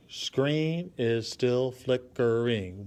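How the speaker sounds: slow "Screen is still flickering", one beat per syllable. Shown as a demonstration. Teacher counts a six-syllable poem line, syllable by syllable. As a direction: break "flickering" into flick, er, ing. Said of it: "a syllable is kinda like a beat".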